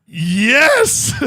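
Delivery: loud, high cry of victory; triumphant yes. Real, not acted. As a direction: celebrate like you scored. Triumph after the code name.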